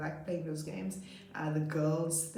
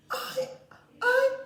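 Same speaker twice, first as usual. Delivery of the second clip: put-on child voice; 8 dB louder than usual.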